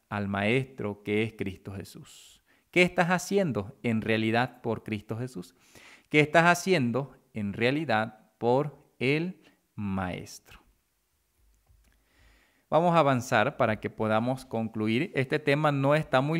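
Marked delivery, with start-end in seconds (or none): 6.1-10.6 deliberate question with pauses between phrases; measured rhetorical challenge. Real, not acted. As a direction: space out "por el Maestro" word by word. Question repeated slowly for weight.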